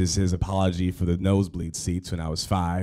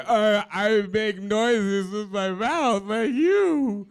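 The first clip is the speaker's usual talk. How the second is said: choked, much higher and slow, on the verge of sobbing; tearful high voice; 11 semitones higher, slower than usual. Retold or imitated. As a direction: blurt it through tears to your idol. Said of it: "And I stop crying".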